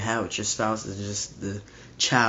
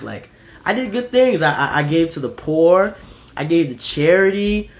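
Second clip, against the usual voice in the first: louder, higher pleading defendant listing good deeds; 3 semitones higher, 5 dB louder than usual. Retold or imitated. Defendant begging the judge, louder, higher pleading. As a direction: plead your case louder and higher, stammering. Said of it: "He says".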